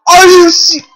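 high, loud exasperated yell; exasperated shout. Real, not acted. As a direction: shout in utter disbelief. Latias appears again.

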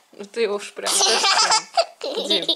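high laughing remark. Real, not acted. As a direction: laugh while you say it. Laughing at child's rough rocking.

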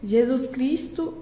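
normal talking voice

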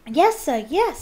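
high, loud, eager elf yeses; eager house elf. A narrator's reading. Dobby eagerly answers Harry.

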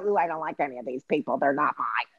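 soft, fast, sniffy brat; snooty brat. Retold or imitated. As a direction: dismiss them like a spoiled brat. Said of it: "the brat will go".

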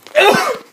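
high, loud exaggerated cough; silly put-on cough; weird fake cough. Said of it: "I don't really cough that weird".

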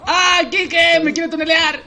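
high panicked yell for help; panicked shout. Real, not acted. Panicked call in game.

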